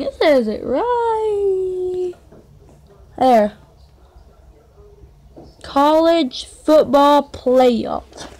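slow, loud spelled-out College football playoff; slow emphatic reading. Real, not acted. Kid proving the ball's label, slow loud reading. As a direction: drag each word to prove it. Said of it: "See, I'm not even lying".

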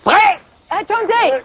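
loud call, pitched high. Acted. Woman calls after Tony leaving with his suitcase, loud high call. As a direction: shout after him to stop. Calling to the departing man.

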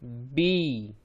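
बी as b plus long ee; blended syllable demo. Shown as a demonstration. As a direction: draw out the vowel in बी. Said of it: "तो बन गया".

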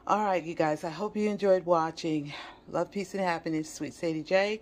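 ordinary talk, nothing marked

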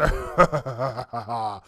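low, rolling ha-ha-ha; mock villain chuckle; triumphant laugh. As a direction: gloat with a long laugh. Triumphant pilot after landing.

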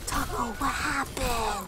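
slow, drawn-out pet voice; fussing over a cat; talking to cat. Retold or imitated. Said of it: "I talk to my cat like".

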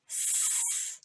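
С hissed alone, no voice; isolated sound. Shown as a demonstration. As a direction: give the bare hissing С sound. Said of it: "чітко вимовляти звук". (глухий звук С)

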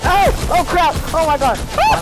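panicked yelling. Real, not acted. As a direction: cry out in panic. Ambushed in the fight.

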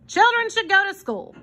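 high-pitched, emphatic hobo; lightly acted lecturing; hobo Armand. A narrator's reading. Armand telling children about school, lightly voiced insistence.

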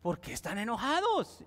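lightly acted bewilderment; confundido. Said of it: "Y él confuso". (imitando a un anciano desconcertado)

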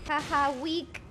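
usual speaking voice

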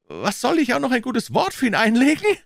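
high, outraged yell; indignant outburst, rising to the end; indignant shout. A narrator's reading. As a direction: shout back in disbelief at the request. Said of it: "schrie Stetland".

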